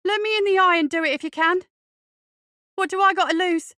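high, loud reckless outburst; desperate nothing-to-lose defiance; furious challenge. Acted. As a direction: shout the bully down, pitched right up. Radley's furious outburst at bully.

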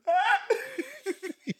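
very high mock scream; imitated crowd scream. Friend imitates people screaming as floor caved, screaming. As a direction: squeal like people as a floor gives way. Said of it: "to people screaming".

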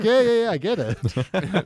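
slow, high bored dismissal; dismissive brush-off. Retold or imitated. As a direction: drag it out, unimpressed. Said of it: "he's like".

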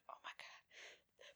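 slow breathy whisper of dread; panicked whisper. Retold or imitated. Recalling the aisle before her shot, slow scared whisper. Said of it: "and I was like".

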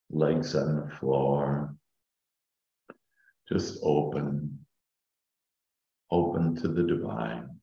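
slow, soft meditation voice; calm meditative tone. Acted. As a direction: ease them down into calm. Leads a guided meditation.

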